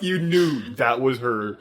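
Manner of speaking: ordinary talk, nothing marked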